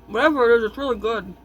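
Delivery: talking while chewing; words round a mouthful of food; mouth full. Real, not acted. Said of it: "I'm eating this thing now".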